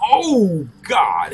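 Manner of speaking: high, loud startled "oh" that drops off; watching a brutal slap video, shocked; shocked gasp. Retold or imitated. Jumped back watching video.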